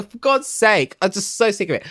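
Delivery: normal talking voice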